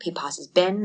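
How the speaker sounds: usual speaking voice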